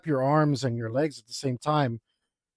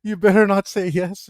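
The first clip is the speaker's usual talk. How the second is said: warning; 5 semitones higher, 6 dB louder than usual. Retold or imitated. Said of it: "shooting darts at him with my eyes".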